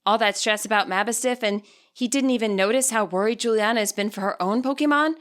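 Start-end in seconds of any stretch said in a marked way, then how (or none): none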